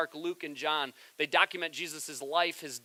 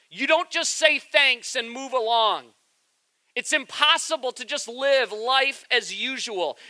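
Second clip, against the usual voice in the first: louder and higher, slow, building preaching; preaching at full force; 6 semitones higher, 6 dB louder, slower than usual. Acted. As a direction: build it up to a passionate peak.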